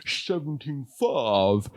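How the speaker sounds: slow, deadpan price quote; a hint of Hitchcock. Comedian still in a light Hitchcock voice quoting a price. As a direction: draw out the price slowly and dry. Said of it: "See how I did that".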